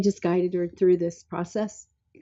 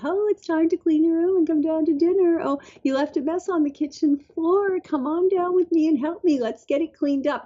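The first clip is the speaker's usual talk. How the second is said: high-pitched, acted-out mom chivvying a small child; high parental coaxing; 10 semitones higher than usual. Mom telling her four-year-old to clean up, high-pitched coaxing. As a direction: imitate a mom's raised, sing-out call to tidy up. Voicing past requests to her kid.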